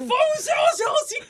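high, loud theatrical declaim; hammed-up battle cry; mock heroic shout. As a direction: proclaim it like an ancient warrior. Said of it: "大喝一声".

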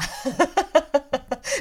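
尖亮的笑声往上扬; 忍不住高声笑出来; 高声笑. Real, not acted. 讲到研究风水自己笑. (高声大笑)

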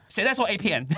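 high, sharp mock yell from a strict homeroom teacher; retold teacher yell. Homeroom teacher silencing the class with a high mock yell. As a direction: bark the question like a teacher slamming a pointer. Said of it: "砰一声说".

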